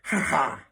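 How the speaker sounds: short put-on "ha ha"; scornful two-beat stage laugh; mocking laugh. Matron laughing at her own insult, mocking.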